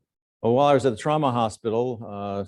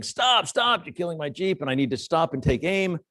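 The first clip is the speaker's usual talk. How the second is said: protesting yell; 8 semitones higher than usual. Retold or imitated. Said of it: "Chuck Swanson saying".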